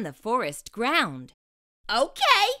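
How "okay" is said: high excited yell. Acted. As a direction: shout it out. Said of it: "he yelled".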